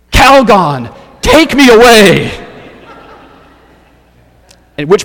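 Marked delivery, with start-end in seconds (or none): 0.1-2.3 loud, high plea shouted to the heavens; crying out. Acted. Frazzled woman begs bath product for rescue, crying out loudly. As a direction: belt it out like an overwrought commercial heroine. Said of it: "and she cries out".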